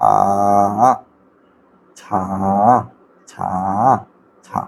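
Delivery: আ and ছা as separate sounds with long pauses; isolated phonic sounds. Shown as a demonstration. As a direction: sound out আ, then ছা, one by one. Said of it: "sound কিন্তু তিনটা". (আ, ছা আলাদা আলাদা ধ্বনিতে বলা)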